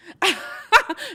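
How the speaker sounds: high-pitched burst of laughter; loud high laugh. Real, not acted. Laughs at the joke.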